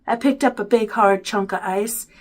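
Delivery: usual speaking voice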